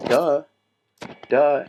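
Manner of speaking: loud sarcastic duh, as if it's obvious. Real, not acted. Dismissing the apology.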